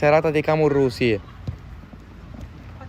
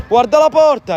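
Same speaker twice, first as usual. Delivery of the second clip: high, loud sudden cry; excited shot call; 10 semitones higher, 7 dB louder than usual. Real, not acted. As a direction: punch it out as the shot comes. Shot on goal called. (grido concitato)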